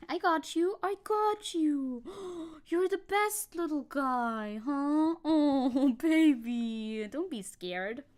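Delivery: high, slow soothing coo. Real, not acted. Streamer soothes her scared dog under the chair in high slow baby talk. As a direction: reassure the frightened pup.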